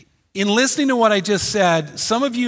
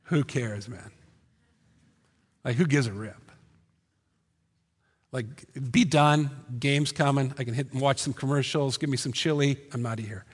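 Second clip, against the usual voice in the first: lower, softer lazy shrug of a voice; bored listener; 5 semitones lower, 7 dB quieter than usual. Pretend. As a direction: play the indifferent churchgoer who wants the game. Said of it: "Here's what some of you are thinking".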